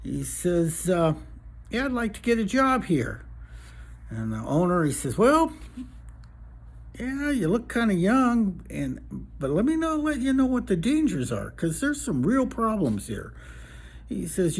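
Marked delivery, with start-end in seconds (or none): 1.7-3.2 lightly voiced eager young guy, higher; a touch higher, as a hopeful newcomer; young job seeker. Young guy asking the bar owner for a job, lightly voiced. 5.2-13.3 lightly voiced old-West boss, higher; cautioning bar owner. Bar owner warning a young applicant of dangers, lightly voiced caution.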